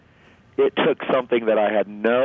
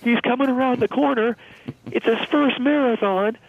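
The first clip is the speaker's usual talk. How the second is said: much higher, mock rallying cry; retold crowd yell. Pacer rallying marathon crowd for struggling runner, high mock yell. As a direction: imitate a runner hyping up the crowd, pitched way up and slowing at the end. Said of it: "did the exact same thing".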